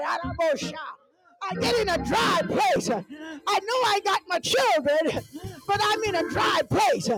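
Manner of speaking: rhythmic fervent sermon cadence; preaching. Real, not acted. Pastor preaching to the congregation about dry places. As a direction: preach it with rising fervor.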